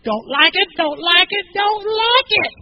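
hammed-up stubborn refusal, rising in pitch; escalating protest. Acts out his own blunt objection.

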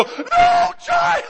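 very high, loud shriek dissolving into laughter; laughing shout. Retold or imitated. Dad shrieking at the toddler's cheek, high and laughing. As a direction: squeal no child while cracking up. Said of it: "I go".

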